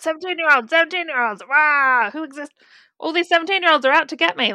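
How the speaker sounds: hammed-up grouchy policeman; ranting anti-teen cop. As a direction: imitate a cop grumbling about teenagers. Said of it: "that one cop that's, like, anti-teen".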